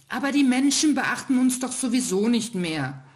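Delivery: hohe, lautere trotzige Kinderstimme; trotzig. Acted. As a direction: bockig dagegenhalten. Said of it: "meinte der kleine Stern trotzig". (kleiner Stern hält trotzig gegen den ältesten Stern)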